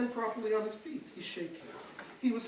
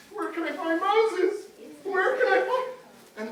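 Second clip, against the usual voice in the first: higher, louder and slow, pleading; frightened plea; 6 semitones higher, 7 dB louder, slower than usual. Acted. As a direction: beg the kids for directions. Frightened Pharaoh asks kids.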